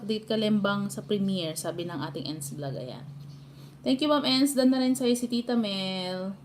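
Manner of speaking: ordinary talk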